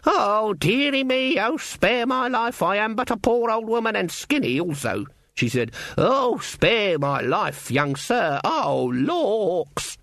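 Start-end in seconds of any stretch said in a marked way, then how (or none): 0.0-5.0 quavery mock-pitiful wail; old lady act. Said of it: "Granny's old lady act". 6.0-10.0 high, slow, hammed-up pleading; quavery mock-pitiful wail; old lady act. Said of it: "Granny's old lady act".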